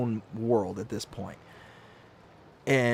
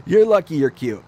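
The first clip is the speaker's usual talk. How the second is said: teasing; 2 semitones higher, 11 dB louder than usual. Retold or imitated. Teasing wife affectionately.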